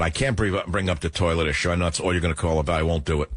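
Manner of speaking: normal talking voice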